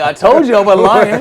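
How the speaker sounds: high, loud I-told-you-so; triumphant vindication. Retold or imitated. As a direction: shout it back at your doubting grandmother. Retold vindication to doubting grandmother.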